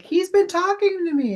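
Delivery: high-pitched, retold amazement; astonished realization. Medium telling the client who visited her, astonished. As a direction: let the voice leap up in disbelief.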